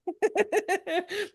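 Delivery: a giggle pitched well up; quiet high laughter; high real laugh. Real, not acted. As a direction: laugh at your own story. Laughing at the story.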